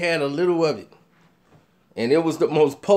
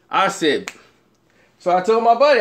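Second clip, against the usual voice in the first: ordinary talk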